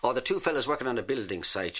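ordinary talk

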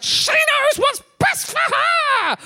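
high, slow, furious shout. Retold or imitated. Comic yelling at the Starbucks barista, a slow furious high shout. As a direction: explode at the barista, drawing out every word. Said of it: "I was like".

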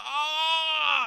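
high, loud yell of pain. Retold or imitated. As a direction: cry out as you hit the ground. Lad lands and breaks ankles.

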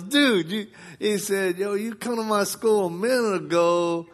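put-on high, excited teen voice; imitating teen. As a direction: play the kid's amazement. Imitating teen who recognises him.

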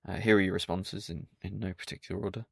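ordinary delivery

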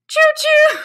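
high, loud choo-choo; playful high-pitched train call; train whistle imitation. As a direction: hoot like a toy train.

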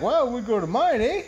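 high, lifted Canadian chat-up voice; mock-eager Canadian accepting an invitation; Canadian bloke. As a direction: pitch it up and end on a Canadian eh. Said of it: "He's like".

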